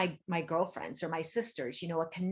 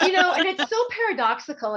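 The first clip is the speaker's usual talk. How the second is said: high, loud laugh through the words; 10 semitones higher, 8 dB louder than usual. Real, not acted. Telling the host while laughing, high and loud. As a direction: laugh it out, pitch up.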